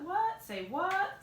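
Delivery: playful fake astonishment at the next step; mock surprise. Vlogger teasing viewers about the coming step, mock-surprised.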